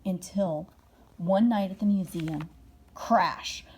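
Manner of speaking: ordinary delivery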